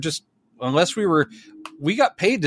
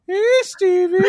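dumb voice. Retold or imitated. Tour guide calling to a director from a golf cart in a dumb voice. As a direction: sing out a dopey greeting to a stranger. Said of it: "I did it just like that with a dumb voice".

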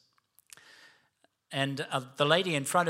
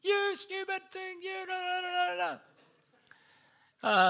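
mock screaming rant. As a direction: squeal out a comic stifled tirade that dissolves into gibberish. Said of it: "and started screaming".

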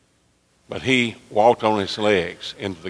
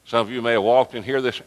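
usual speaking voice

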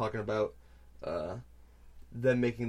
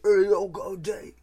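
a hammed-up bad British accent, higher and louder; terrible British accent; 9 semitones higher, 9 dB louder than usual. Quoting the pig boss from the trailer, terrible British accent. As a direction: ham up a British thug accent badly. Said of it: "in the terrible British accent".

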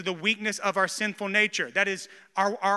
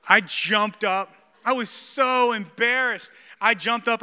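emphatic retelling; 3 semitones higher, 7 dB louder, slower than usual. Retold or imitated. Retelling humiliation, jumping up.

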